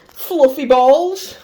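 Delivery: high, slow, teasing delight; playful high voice. Pretend. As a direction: savour the words like a treat. Gleefully names mic accessories.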